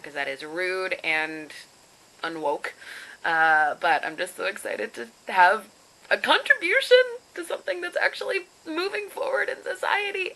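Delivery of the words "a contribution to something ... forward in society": mock-lofty. Self-mocking about a worthy project.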